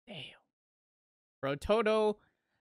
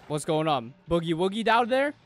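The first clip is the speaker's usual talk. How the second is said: ordinary talk, nothing marked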